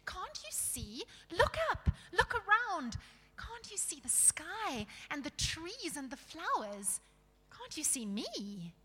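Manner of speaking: put-on high, insistent girl's voice; pleading girl. Lucy begging dwarves to look around, high pleading.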